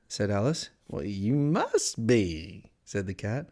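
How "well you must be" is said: slow, drawn-out cat character voice; sly Cheshire Cat. A narrator's reading. Cat answering Alice's question, slow and teasing. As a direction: stretch the words out in lazy riddles.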